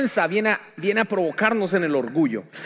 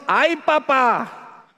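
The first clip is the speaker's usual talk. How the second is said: lamento; 6 semitones higher, 4 dB louder, much slower than usual. Acted. Voz dramatizada del lamento.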